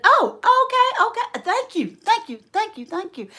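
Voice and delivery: high, flustered string of thanks; shocked. Retold or imitated. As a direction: imitate an overwhelmed older lady. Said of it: "like, shocked and said".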